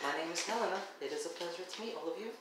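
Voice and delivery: high, soft angelic voice; Helena. Acted. Helena introduces herself to the party, angelic voice. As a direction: speak softly and high, like an angel. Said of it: "angelic voice".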